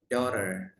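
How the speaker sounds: American "daughter" with a sounded final r; American pronunciation. Shown as a demonstration. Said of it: "Kalau American". ("daughter" logat Amerika)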